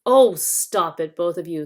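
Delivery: stopping a quarrel. Acted. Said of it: "said King Caspian".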